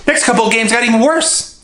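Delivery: ordinary talk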